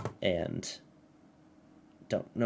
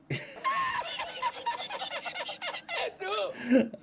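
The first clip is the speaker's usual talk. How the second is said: laughing; 3 dB quieter than usual. Real, not acted. Reading a ridiculous apology letter.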